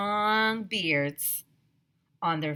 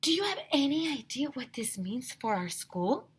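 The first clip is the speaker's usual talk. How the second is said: higher lady teacher voice; Missus Jaffe's voice; 7 semitones higher than usual. A narrator's reading. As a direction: dream aloud. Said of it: "asked Missus Jaffe".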